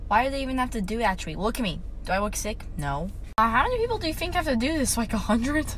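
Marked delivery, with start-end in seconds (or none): none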